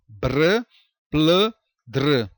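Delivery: "Br, pl, dr" as separate short bursts; isolated consonant clusters. Shown as a demonstration. Teacher showing clusters within the same syllable, sounded out separately. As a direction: sound out "Br, pl, dr", each blend as one syllable. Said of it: "Todos na mesma sílaba".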